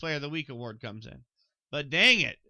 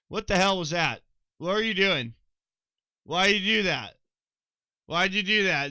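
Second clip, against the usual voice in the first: louder, baffled scolding of the quarterback; exasperated scolding; 3 semitones higher, 6 dB louder than usual. Pretend. Host scolding Atlanta over a zero-point game, exasperated and louder. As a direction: chew out the team like a let-down friend.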